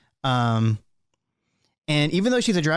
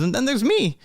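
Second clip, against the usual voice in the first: put-on high, happy old gnome; Burgle Timbers; 11 semitones higher than usual. Old gnome greets young friend, high elderly voice. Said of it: "And he goes".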